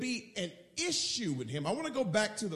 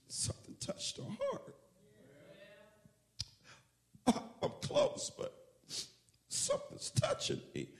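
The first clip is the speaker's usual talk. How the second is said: tearful; 3 semitones higher, 6 dB quieter than usual. Acted. Preacher acting out the weeping woman drawing close to Jesus, tearful and hushed. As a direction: act out being moved almost to tears, haltingly. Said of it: "I'm acting it out, ... almost real tears".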